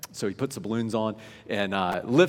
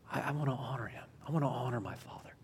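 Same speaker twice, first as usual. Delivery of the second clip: soft, earnest whisper; very quiet, reverent near-whisper; hushed devotion; 10 dB quieter, faster than usual. Real, not acted. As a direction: whisper it like a private vow.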